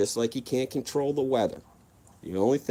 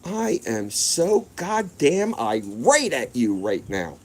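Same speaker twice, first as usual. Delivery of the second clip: imitating angry mom; 2 semitones higher, 3 dB louder, slower than usual. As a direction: drag out every word like a fuming mother. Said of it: "She said".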